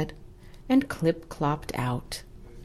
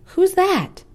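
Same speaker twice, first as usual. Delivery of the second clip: much higher librarian voice; puzzled librarian; 13 semitones higher, 6 dB louder than usual. Acted. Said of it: "she asked".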